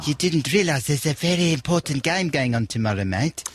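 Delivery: light mock South African accent, tagging on mate. DJ hyping the football match, light mock South African accent. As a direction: keep up the put-on South African voice. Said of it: "It's the South African one".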